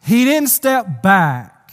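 "step back" drawn out slowly and punched; emphatic contrast. A narrator's reading. Pastor marvelling at Judah's courage, slow emphasis on "back".

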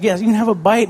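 indulgent yes. Retold or imitated. Father to his pleading son, happily giving in. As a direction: say yes like you can't refuse. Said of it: "You know, it's like".

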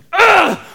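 high strained groan of effort; tired grunt. Acted. Soldiers tire at the gates, strained groan. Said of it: "we start to get tired".

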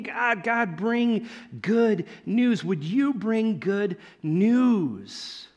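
drawn-out, slow plea; slow pleading. Retold or imitated. Wandering people begging God for news, slow plea. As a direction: ask God slowly, yearning.

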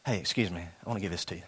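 a low, soft-spoken offer of money; hushed stranger offering cash. Retold or imitated. Stranger offering a gift, hushed. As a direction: quietly hand it over like a kind stranger.

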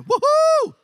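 loud, very high woohoo; high whooping cheer. Real, not acted. Preacher celebrating revival among the students, whooping.

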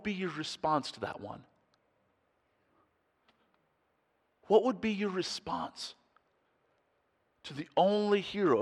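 earnest appeal. Real, not acted. Soft, slow appeal.

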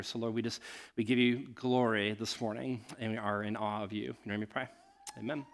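low, softening prayerful voice; hushed reverent close to a prayer; spoken prayer. Real, not acted. Pastor leads congregation in prayer.

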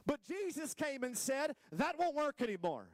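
high, emphatic sermon proclamation; forceful preaching. Real, not acted. Preacher announcing what Jesus came and said, high and forceful. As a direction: proclaim it with rising fervor.

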